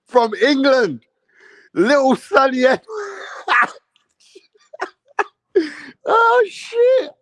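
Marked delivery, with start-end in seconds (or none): 2.9-3.7 high, wild burst of laughter; squealing helpless laugh; shrieking laugh. Real, not acted. Explodes laughing at the fight. 6.1-7.1 very high, crying with laughter; shrieked disbelief mid-laugh. Real, not acted.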